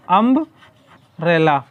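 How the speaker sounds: Umbrella stretched very slowly, each sound held; slow drawn-out say-it-aloud. Shown as a demonstration. Teacher sounding out umbrella for the class, slow and stretched. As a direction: draw out umbrella slowly so students hear it. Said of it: "उच्चारण करो".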